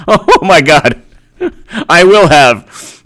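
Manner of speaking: loud, slow laughing joy; gleeful loud laughter. Real, not acted.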